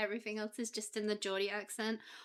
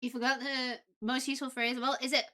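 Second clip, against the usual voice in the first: ordinary talk, nothing marked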